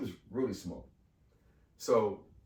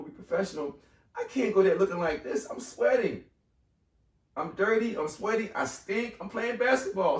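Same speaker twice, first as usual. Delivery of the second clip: high, louder, anxious rant; retold panic; 9 semitones higher, 4 dB louder than usual. Sweaty candidate panicking to himself before the interview. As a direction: spill the worries out in a rush. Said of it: "I'm like".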